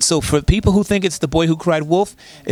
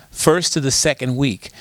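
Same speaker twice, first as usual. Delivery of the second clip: usual speaking voice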